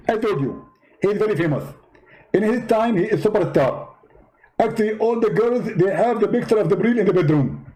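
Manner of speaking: high, indignant preacher voice; Zakir Naik impression. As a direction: play the offended debater, pitched well up. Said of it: "Zakir Naik?".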